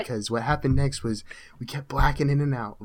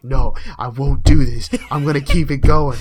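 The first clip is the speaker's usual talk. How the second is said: much louder, a stubborn mock yell; defiant shout; 3 semitones higher, 14 dB louder than usual. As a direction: bellow it like nothing can stop you. Retold drunk bravado to friend.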